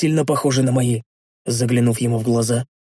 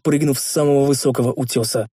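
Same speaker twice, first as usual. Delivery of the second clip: ordinary delivery, nothing marked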